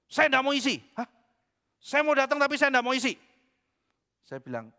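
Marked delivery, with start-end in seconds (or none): 0.1-3.1 plus aigu et plus fort, buté et hautain; voix du pasteur arrogant. Retold or imitated. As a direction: imiter un homme têtu qui refuse tout. Pria sombong menolak isi formulir.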